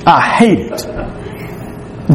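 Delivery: very slow, breathy near-whisper on hate it; hushed confession. Real, not acted. Pastor confesses to his church he hates phone calls, very slow and hushed. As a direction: let the words sink, almost a whisper.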